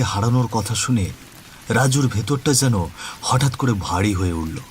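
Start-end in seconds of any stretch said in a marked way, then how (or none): none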